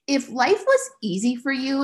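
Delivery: normal talking voice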